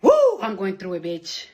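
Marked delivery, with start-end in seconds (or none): 0.0-0.4 sudden high-pitched woo, louder; high whoop. Real, not acted. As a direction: let out a wild whoop. Sudden release of frustration.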